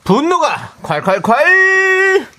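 high, slow announcer shout; stretched title shout. Acted. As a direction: belt out the segment title. Segment title jingle shout.

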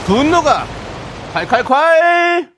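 high, loud drawn-out call; excited shout. Acted. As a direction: belt it like a radio jingle. Jingle-style shouted segment call.

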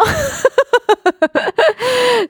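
high bursting laugh. Real, not acted. Laughs at own aegyo.

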